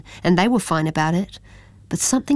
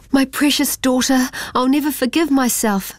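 crying out; 7 semitones higher than usual. A narrator's reading. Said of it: "cried Ming's mum".

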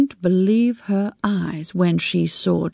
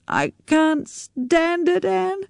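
slow, higher, tearful plea; sobbing; 10 semitones higher, much slower than usual. Acted. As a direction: sob out the words to her friend. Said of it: "Jackie sobbed".

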